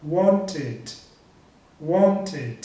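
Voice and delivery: "wanted" in two clear syllables, want-id; ED as extra syllable. Shown as a demonstration. Teacher modelling verbs ending in T, "wanted" with added syllable. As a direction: sound the T, then "id" in "wanted". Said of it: "Como se eu tivesse adicionando uma outra sílaba".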